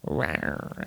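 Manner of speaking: low soft mock roar; a low, soft playful rawr; playful monster roar. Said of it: "I'm a monster".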